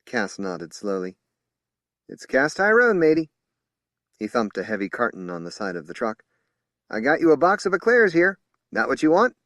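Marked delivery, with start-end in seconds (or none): 2.1-3.3 higher, louder hearty hello; friendly greeting. A narrator's reading. Ice cream man greeting blind woman, hearty. 6.9-9.4 higher, louder friendly man voice; hearty offer. A narrator's reading. Ice cream man offering eclairs, loud and friendly.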